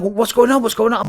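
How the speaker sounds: mock a panicking doctor; freaking out. Surgeon panics as patient wakes thrashing, high alarmed question. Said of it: "they're freaking out".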